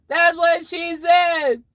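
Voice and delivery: drawn-out mock shout; shouted punchline. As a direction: bellow the joke out.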